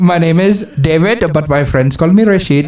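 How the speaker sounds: comic Indian call centre accent; polite Indian-accented phone agent. Shown as a demonstration. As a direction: introduce yourself in a put-on Indian accent. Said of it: "I can do an Indian accent".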